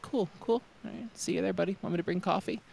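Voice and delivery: high, soft breezy voice; sweetly casual; imitating Joey. Mimicking Joey ending the talk, high and soft. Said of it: "he'd be like".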